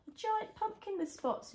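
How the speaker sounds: high excited pointing out. Real, not acted. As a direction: show it off with delight. Pointing out picture.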